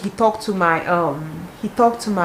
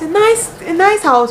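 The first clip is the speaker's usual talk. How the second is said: excited; 10 semitones higher, 6 dB louder, slower than usual. Real, not acted. Excited description of house.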